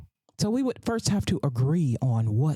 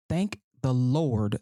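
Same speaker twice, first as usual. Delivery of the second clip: ordinary delivery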